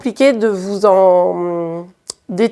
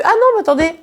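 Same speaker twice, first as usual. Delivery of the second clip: mock surprise; 9 semitones higher, much faster than usual. Suddenly remembers forgotten advice.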